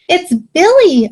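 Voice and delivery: small, high goat voice, drawn out slowly; tiny bleating voice. A narrator's reading. Young goat pleading at the bears' door, tiny bleating voice. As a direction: bleat it like a frightened little goat. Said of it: "a tiny voice bleated".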